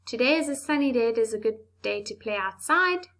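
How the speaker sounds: fast run-on reading with no stops; run-on sentence rushed without pauses; rushed, muddled. Shown as a demonstration. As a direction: gabble it all as one breathless run. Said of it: "That sounds very muddled and confusing".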